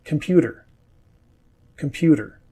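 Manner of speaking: computer with the T tapped like a D; flapped T. Shown as a demonstration. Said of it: "it sounds more like a D".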